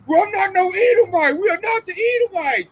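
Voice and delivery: high, mocking denial voice. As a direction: pitch it way up, a whiny denial. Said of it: "and they".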